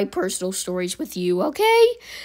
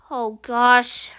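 ordinary delivery

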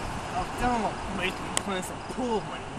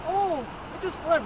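higher, falsely surprised voice; mock innocent voice; 10 semitones higher, 4 dB louder than usual. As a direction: play dumb about the flood. Imagined bystander voiced.

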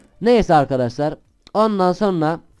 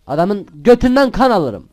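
excited brag; 6 semitones higher, 7 dB louder, slower than usual. Real, not acted. Boasting during game.

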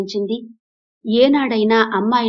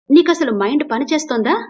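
raised, heated, higher and louder; angry retort; 6 semitones higher, 4 dB louder than usual. A narrator's reading. Husband losing his temper at his insulting wife, angry. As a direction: snap at her, barely holding in your anger. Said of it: "అన్నాడు కోపాన్ని ఆపుకోలేక".

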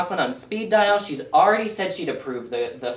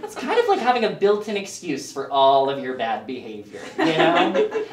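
ordinary delivery

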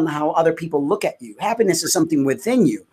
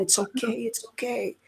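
soft, hushed, higher reassurance; imitated comforting; 4 semitones higher, 12 dB quieter than usual. Voicing God hugging and telling her, tender reassurance. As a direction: soothe gently, barely voiced, as in a hug.

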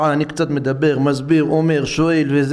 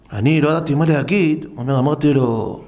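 usual speaking voice

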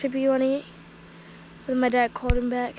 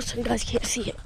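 ordinary talk, nothing marked

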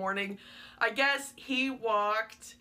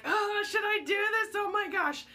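excited gasp; 8 semitones higher than usual. Retold or imitated. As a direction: replay her own stunned yelp. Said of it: "So I was like".

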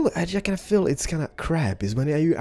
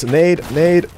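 grenade warning yell; 5 semitones higher, 7 dB louder than usual. Real, not acted. Grenade incoming in battle.

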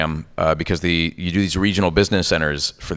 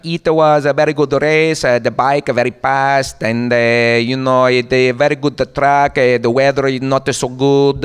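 put-on high, loud Italian-accented rider; thick Italian accent; 7 semitones higher, 5 dB louder, slower than usual. Racer in a post-race interview, thick Italian accent. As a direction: imitate an Italian racer in heavily accented English. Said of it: "he's authentically Italian, so for him".

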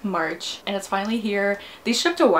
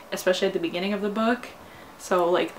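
ordinary talk, nothing marked

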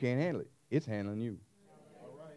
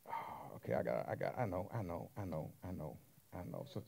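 soft aside; 8 semitones lower, 13 dB quieter than usual. Real, not acted. Preacher answering crowd aside.

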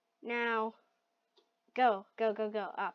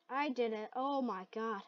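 ordinary delivery

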